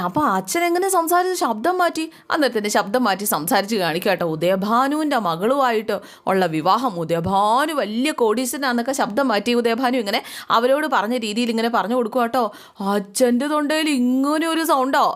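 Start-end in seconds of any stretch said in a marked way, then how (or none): 0.1-2.0 higher, a lightly put-on child's voice asking; curious child. Children question father's changed voice. 13.0-15.1 higher, slow, mock amazed question; shocked children. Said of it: "മക്കൾ ഞെട്ടി നിൽക്കുക".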